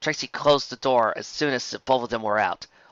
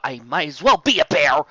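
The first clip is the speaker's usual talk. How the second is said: mild exclamation; 4 semitones higher, 6 dB louder than usual. Acted. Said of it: "Lyra exclaimed".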